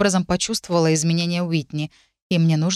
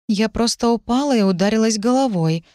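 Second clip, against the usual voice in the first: guarded, higher-pitched explanation; a careful, slightly raised young woman's voice; cautious; 5 semitones higher, 3 dB louder than usual. A narrator's reading. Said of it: "осторожно произнесла я".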